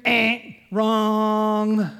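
loud correction. Acted. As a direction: cut the excuse off hard. Pastor corrects the excuse.

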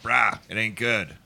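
playful mock New Orleans accent; thick New Orleans accent. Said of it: "a really thick New Orleans accent".

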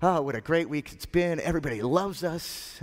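high, gushing anticipation; giddy, starting very high and easing down; excited disciples. Acted. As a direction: burst in thrilled about dinner. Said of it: "with all that excitement".

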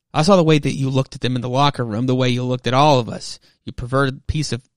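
acted outburst of disgust, loud and pressing; angry accusation. Dan angrily accuses James, gets cut off.

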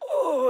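very high imitated pained "Oh"; retold groan of pain. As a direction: voice the dad writhing with a high cry. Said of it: "writhing in this much pain".

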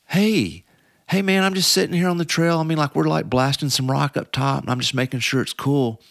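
high, easygoing dude voice; laid-back trail guy. Retold or imitated. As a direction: play a chill guy at his post.

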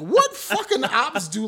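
high, loud, drawn-out disbelief with a laugh; incredulous retort. Retold or imitated. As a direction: throw the question back, utterly baffled. Baffled reply in retold exchange.

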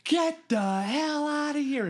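high, slow, shouted order to get out; angry yell. Retold or imitated. Counselor catching kids in the cabin, yelling them out. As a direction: bellow it like a furious counselor. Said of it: "And he goes".

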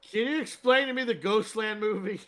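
high, loud mock question; pestering fan. Fan asking the tired actor about a film, high and loud. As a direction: badger him as a nosy passenger.

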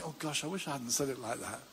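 low, soft rueful aside; acted regret. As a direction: mildly kick yourself, voice dropped and softened. Acts out a believer's regret.

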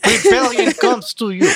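put-on Russian accent. Repeats Soviet joke.

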